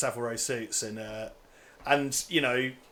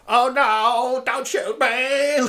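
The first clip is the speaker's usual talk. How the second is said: high, drawn-out mock plea; hammed-up fake-frightened voice, slow and shrieky; Bill Clay; 12 semitones higher, 7 dB louder, much slower than usual. Podcaster mimicking the villain posing as hostage, silly high voice. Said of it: "Like that stupid Bill Clay voice".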